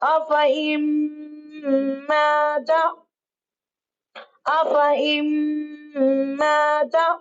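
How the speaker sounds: अफ़इम माता recited with careful Arabic sounds; tajweed demo reading. Shown as a demonstration. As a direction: model the correct reading slowly. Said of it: "इस तरह से पढ़ेंगे". (अफ़इम माता को तजवीद से पढ़ना)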